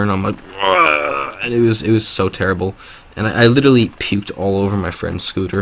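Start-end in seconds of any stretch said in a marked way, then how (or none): 0.6-1.4 higher-pitched mock vomiting noise; imitated retching. Teller acts out throwing up everywhere, mock retch. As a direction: make a hammed-up puking sound. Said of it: "throwing up everywhere".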